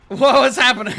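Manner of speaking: loud, high laughing question. Real, not acted. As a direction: laugh through the words. Laughing at the glitch.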